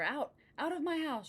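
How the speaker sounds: high storybook shout; crying out. A narrator's reading. Witch shoves the cat toward the door, high cry. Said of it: "she cried".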